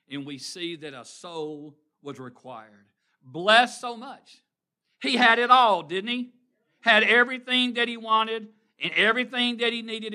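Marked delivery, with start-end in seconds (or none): 3.2-10.1 preaching at full force. Real, not acted. Preacher driving the point home to the congregation. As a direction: lift them with a rising preach.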